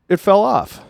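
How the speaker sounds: high, loud disbelief at it falling off; stunned disbelief, high and loud; incredulous. Real, not acted. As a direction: say it like you can't believe it. Incredulous repeat of statement.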